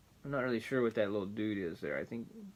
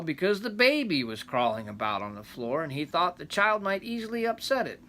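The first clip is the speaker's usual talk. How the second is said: rambling grandpa story; 4 semitones higher, 4 dB louder than usual. A narrator's reading. Reading the folk tale aloud.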